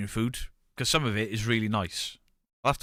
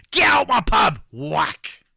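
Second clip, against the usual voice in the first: higher, much louder furious yell; landlord shout; 10 semitones higher, 10 dB louder, slower than usual. Pretend. Pretend landlord throwing punters out, furious yell. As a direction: throw them out of the pub. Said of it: "smack people over the head".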